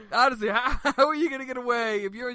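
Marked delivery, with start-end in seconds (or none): none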